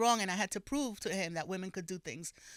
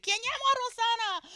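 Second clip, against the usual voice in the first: much higher, bright operator voice; switchboard lady; 5 dB louder than usual. Retold or imitated. Daisy answers reporter's call, high operator voice. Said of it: "she'd be like".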